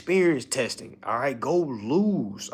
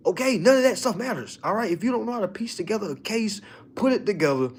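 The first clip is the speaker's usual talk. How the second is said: ordinary delivery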